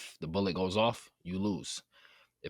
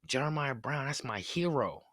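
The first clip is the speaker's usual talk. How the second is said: put-on higher, kid calling his mom, pitched up; excited little kid; 6 semitones higher than usual. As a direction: play an excited boy spotting his hero. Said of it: "and he goes".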